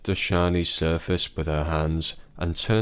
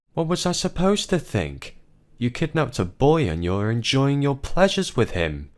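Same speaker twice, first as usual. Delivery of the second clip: accusing wife; 8 semitones higher than usual. Acted. Wife accuses husband in quarrel.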